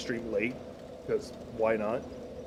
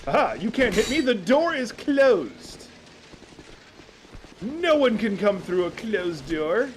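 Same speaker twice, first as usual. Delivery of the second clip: high, loud, slow taunting shout; loud gloating; 8 semitones higher, 4 dB louder, slower than usual. Real, not acted. Gamer mocking enemies who can't get through, loud gloating. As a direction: lord your safety over them. Gloating behind closed door.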